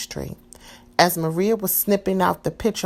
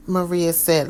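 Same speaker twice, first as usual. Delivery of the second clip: ordinary delivery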